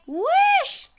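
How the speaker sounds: very high, loud startled shout; shocked yelp. Real, not acted. Sudden shock at dancing customer.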